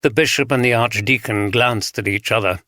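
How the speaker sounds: ordinary delivery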